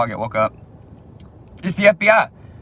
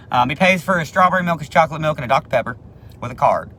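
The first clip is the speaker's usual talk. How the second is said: ordinary talk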